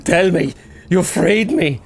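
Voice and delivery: a hint of a desperate plea; lightly voiced begging man; pleading man. Edbert pleads with Simon.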